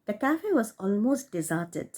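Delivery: ordinary delivery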